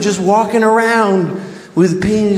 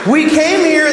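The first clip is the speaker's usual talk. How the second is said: shouting rant; 8 semitones higher than usual. Real, not acted. Comic shouts about calling them Indians anyway. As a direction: yell it in mock outrage.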